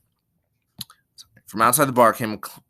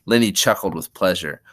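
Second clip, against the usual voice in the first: plain reading voice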